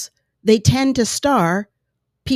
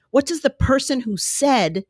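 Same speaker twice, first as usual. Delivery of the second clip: ordinary delivery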